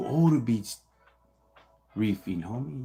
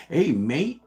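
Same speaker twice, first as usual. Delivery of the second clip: higher, louder mock British accent; 6 semitones higher, 7 dB louder than usual. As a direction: put on a British greeting, pitched up. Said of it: "speak like you're from Europe".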